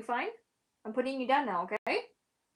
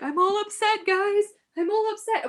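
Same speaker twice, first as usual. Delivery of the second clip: much higher, louder whiny puppy voice; voicing the dog; 11 semitones higher, 7 dB louder than usual. Owner speaks for her upset dog in a whiny puppy voice. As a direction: whine it like a sulky little dog.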